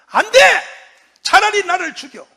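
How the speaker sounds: loud, high cry of refusal; defiant shout. Retold or imitated. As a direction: yell it as if ready to die. 이승만이 미국에 맞서 외침. (죽을 각오로 외치는 높은 고함)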